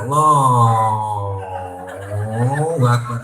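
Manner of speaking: slow, loud, quavering takbir; trembling takbir. Retold or imitated. Preacher mocking a worshipper's shaky takbir, slow and loud. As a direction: let the takbir shake as if startled. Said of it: "Takbir keder".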